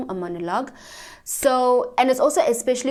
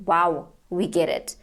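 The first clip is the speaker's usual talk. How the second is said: bored casting director; 3 semitones lower than usual. Retold or imitated. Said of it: "until they stop you because".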